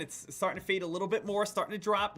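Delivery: usual speaking voice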